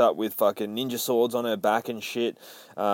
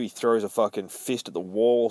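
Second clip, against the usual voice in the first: ordinary talk, nothing marked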